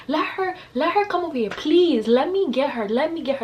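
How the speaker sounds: high, worked-up pleading to get at her; imitating her own fight-hungry taunt; mock heated taunt. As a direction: act out itching to fight her again. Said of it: "I was like".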